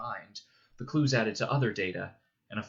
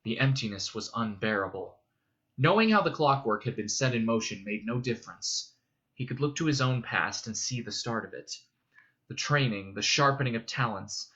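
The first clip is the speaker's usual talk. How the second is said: ordinary delivery, nothing marked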